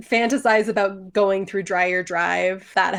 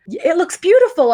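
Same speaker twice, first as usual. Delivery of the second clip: 1 gushing praise; 8 semitones higher than usual. Retold or imitated. Acted praise to the contractor.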